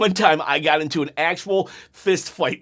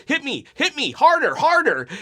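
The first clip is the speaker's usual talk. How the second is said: goading higher voice while being hit; defiant taunt from the ground, pitched up; mock taunt, higher; 8 semitones higher than usual. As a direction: egg them on in a raised, cocky voice. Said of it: "I just start talking trash".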